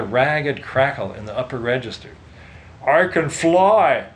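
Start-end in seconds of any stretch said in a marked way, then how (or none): 2.9-4.1 slow, high, loud wet blubber. A narrator's reading. Ganser blubbering after turning into a blob. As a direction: sob the words out as you float. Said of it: "blubbered Ganser".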